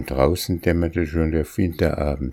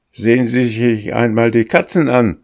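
raised, higher voice calling her to look; 6 semitones higher, 4 dB louder than usual. A narrator's reading. Frightened master to the old housekeeper, raised calling voice. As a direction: urge her loudly to look at the cats. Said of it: "rief er".

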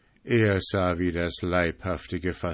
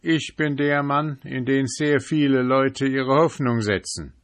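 higher, hearty, self-important voice; hearty merchant; 4 semitones higher, 5 dB louder than usual. Acted. January introducing himself to the sentry, hearty and grand. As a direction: sell yourself as the generous merchant.

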